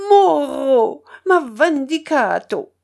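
loud, high operatic cry; sudden tiger-like outburst. A narrator's reading. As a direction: burst out like a tragic hero on stage. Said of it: "comme un tigre".

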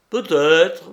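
un mot lancé fort et haut; réponse lancée fort. A narrator's reading. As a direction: jette la réponse avec défi. Le misérable répond d'un mot. (le misérable lance sa réponse)

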